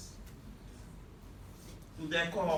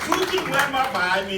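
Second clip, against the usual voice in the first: rallying call; 7 semitones higher, 8 dB louder than usual. Real, not acted. Rallying line to the crowd.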